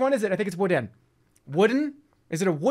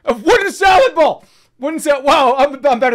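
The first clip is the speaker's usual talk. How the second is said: real excited shriek, louder and higher; excited scream; 7 semitones higher, 8 dB louder, slower than usual. Real, not acted. Streamer finding a wooden salad bowl, screaming with delight. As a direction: yell with delight at the find. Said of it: "I'm sorry, I just screamed".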